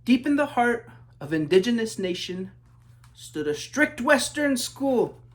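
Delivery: measured rhyming read-aloud; story narration. A narrator's reading. Author reading his book aloud, storybook narration. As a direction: read it like a picture book to kids.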